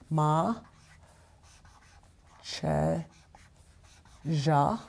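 Ma, Che, Ja as separate syllables with long pauses; sounded out phonetically. Shown as a demonstration. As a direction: break Ma, Che, Ja apart for learners to write. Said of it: "I'm gonna spell it phonetically".

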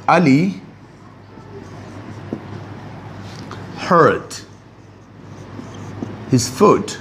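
slow, spaced words with sorrow; sad example sentence. Shown as a demonstration. Teacher demonstrates a sorrowful sentence to the class, slow and spaced. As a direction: demo a sentence of sorrow, word by word. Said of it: "अब मैं कहता हूँ".

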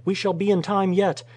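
high-pitched urgent call; urgent cry. A narrator's reading. Rescuer hurrying his mate to the shaft, crying out. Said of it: "he cried".

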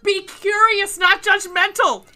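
high, loud coach cheer; loud rallying cry. Acted. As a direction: shout it like a pep talk. Quoting Ted Lasso motto.

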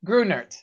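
GRUNERT the American way, "GROO-nert"; American-style Grunert, clear T at the end; T pronounced. Shown as a demonstration. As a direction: repeat Grunert evenly with the final T sounded. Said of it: "you do pronounce the T".

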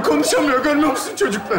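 normal talking voice

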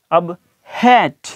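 हैट drawn out very slowly; teacher modelling हैट slowly; slow careful demo. Shown as a demonstration. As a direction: stretch हैट out syllable by syllable. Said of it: "पढ़ा होगा हैट".

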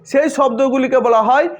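ordinary delivery

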